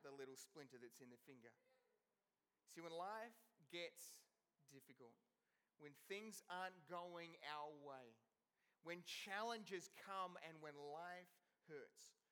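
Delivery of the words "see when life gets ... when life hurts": slow, hushed sermon voice with long pauses; slow soft preaching. Acted. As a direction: draw the congregation in, slowly and quietly.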